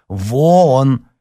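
exasperated shout; shouted dismissal. A narrator's reading. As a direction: throw someone out with one cry. Brother ordering sister out.

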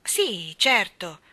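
loud, irritated confirmation. Acted. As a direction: answer with annoyance, leaning on it. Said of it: "Annabella rispose irritata".

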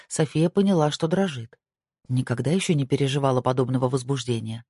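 ordinary delivery, nothing marked

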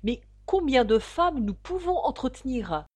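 loud boastful insistence; exclaiming. A narrator's reading. Rival suitor boasts of his wealth, loud and insistent. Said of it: "s'écria l'autre".

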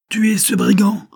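enraged monk screaming at his men; vociferating. A narrator's reading. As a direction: roar the order to kill. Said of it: "vociféra le moine".

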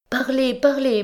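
"Parlez, parlez" climbing high, falling at the end; pleading. Acted. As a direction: beg twice, voice rising. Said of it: "repeated urgent plea".

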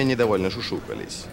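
ordinary delivery

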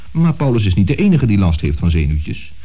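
ordinary delivery

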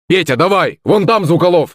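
high-pitched urgent call; shouting. A narrator's reading. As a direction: call out an alarm in battle. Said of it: "закричал Роман".

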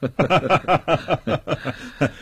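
extended, falling ha-ha-ha; long forced laugh. Acted. Official brushing aside his prisoner's jibe, long laugh.

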